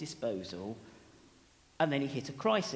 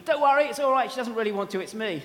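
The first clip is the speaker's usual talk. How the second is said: laughing reassurance; 5 semitones higher, 10 dB louder, faster than usual. Retold or imitated. Reassuring the pranked colleague, laughing.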